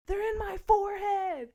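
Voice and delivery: high, loud retold outcry; shocked yell. John finding teeth in his forehead, acted shocked yell. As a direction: yell it in alarm. Acts out John's shocked discovery.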